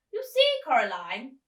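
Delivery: put-on high, loud theatrical old lady; old actress voice. Old actress greets the girl, high and loud. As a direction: gush like a grand old actress. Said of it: "Miss Spink said".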